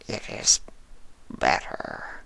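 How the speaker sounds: slow, unvoiced creature whisper; slow changeling. A narrator's reading. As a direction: drag the words out in a dry whisper. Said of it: "the changeling said slowly".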